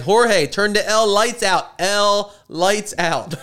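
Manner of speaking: slow, high, over-enunciated shout; mock shouting. Repeating an order to Jorge who didn't hear, mock shouting. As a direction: bellow each word for someone who didn't hear. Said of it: "and he doesn't hear you".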